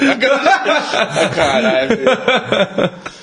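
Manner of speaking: words squeezed out through laughter; can barely talk for laughing; laughing fit, slowed words. Real, not acted. Laughing while trying to speak.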